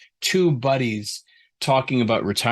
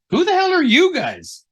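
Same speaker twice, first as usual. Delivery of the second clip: much higher, louder, startled yelp; shocked cry; 6 dB louder than usual. Acted. Mark yelling at the strange creature in shock. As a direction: blurt it out in disbelief.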